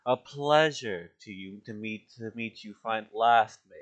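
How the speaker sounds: a touch of an aristocrat's bow; formal courtier. Acted. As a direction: put on polished manners for the mayor. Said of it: "his demeanor completely changes".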